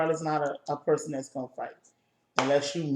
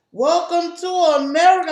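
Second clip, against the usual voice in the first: high, loud mocking welcome; loud put-on bus driver voice; 11 semitones higher, 10 dB louder than usual. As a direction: belt it out like a pushy bus driver.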